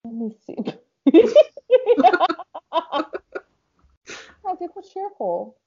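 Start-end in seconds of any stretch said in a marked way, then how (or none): 1.1-5.6 real laughter breaking through, slow and high; cracking up while reading out the choice; laughing. Real, not acted. Laughing as she admits to her friend she picked cheerful. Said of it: "That laugh, though".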